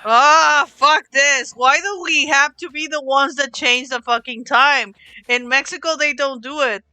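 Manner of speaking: usual speaking voice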